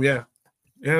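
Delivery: ordinary talk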